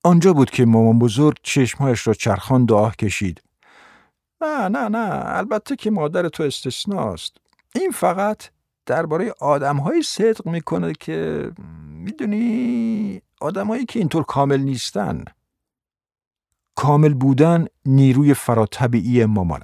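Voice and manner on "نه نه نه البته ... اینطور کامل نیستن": high, soft character voice; soft high protest, drawing out the hedges; backpedalling grandmother. Acted. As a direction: grandmother talking her way out, high and quiet.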